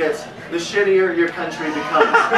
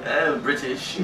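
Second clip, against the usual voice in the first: put-on British accent; 5 semitones lower, 7 dB quieter, slower than usual. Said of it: "'cause you".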